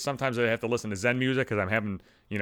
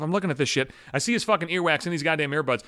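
ordinary delivery

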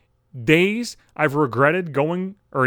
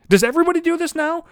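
high, louder disbelieving outburst; exasperated yell; 14 semitones higher, 5 dB louder, faster than usual. Real, not acted. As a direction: shout it at the world in disbelief. Outraged rant about promposals.

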